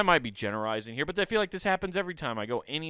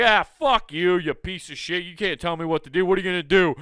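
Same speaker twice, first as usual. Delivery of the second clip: louder, higher mock rant; angry retort; 4 semitones higher, 5 dB louder than usual. Rude driver mouthing off at the scolder, hammed-up anger. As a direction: spit it back at him, mock defiant. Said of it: "And he's like".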